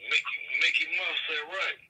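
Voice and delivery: ordinary talk, nothing marked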